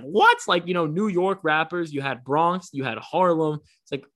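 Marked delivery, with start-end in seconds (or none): 0.0-0.4 high, loud shocked what. Retold or imitated. Fan stunned as [name] walks out. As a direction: blurt it in disbelief. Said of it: "I was like".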